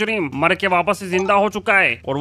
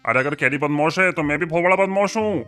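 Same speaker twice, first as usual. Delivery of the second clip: swaggering local goon voice; Tillu Badmash. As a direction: act like the neighbourhood tough guy. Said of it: "मेरा नाम है टिल्लू बदमाश".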